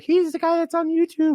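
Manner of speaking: high, loud mocking voice of a relative. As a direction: pitch it way up like a gushing aunt.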